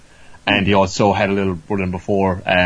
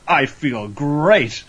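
high, drawn-out boast; revived hero; 6 semitones higher, much slower than usual. Retold or imitated. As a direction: savour every word, stretched out triumphantly. Acted triumphant revival.

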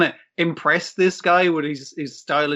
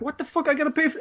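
shocked; 12 semitones higher, faster than usual. Retold or imitated. Retold shocked reaction.

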